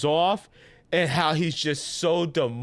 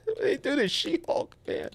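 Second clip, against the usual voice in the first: laughing through the words; amused disbelief, laughing; 12 semitones higher, faster than usual. Real, not acted. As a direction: chuckle it out, high. Laughing at a bad show.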